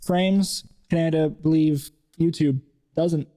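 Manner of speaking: sentence said word by word in reverse; backwards speech. Shown as a demonstration. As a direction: read the words back to front, deadpan. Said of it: "And I'll say it backwards".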